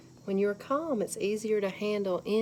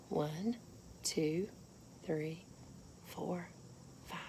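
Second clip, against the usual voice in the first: soft counting; 3 semitones lower, 12 dB quieter, slower than usual. Shown as a demonstration. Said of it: "soft counting demo".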